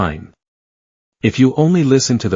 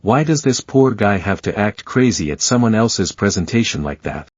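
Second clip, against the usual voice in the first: plain reading voice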